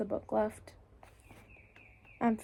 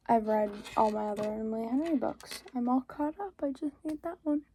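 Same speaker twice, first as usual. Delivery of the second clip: normal talking voice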